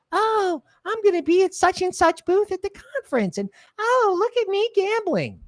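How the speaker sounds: mocking influencer. Mocking crypto influencers showing off at the conference, high mock voice. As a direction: ham up a vain influencer bragging at the booth. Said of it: "all these other influencers like".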